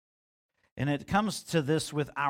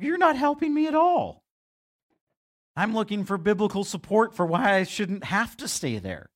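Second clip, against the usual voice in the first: much higher and louder, exasperated; exasperated protest; 7 semitones higher, 4 dB louder than usual. Retold or imitated. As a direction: whine it like a frustrated young pastor. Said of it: "I'm like".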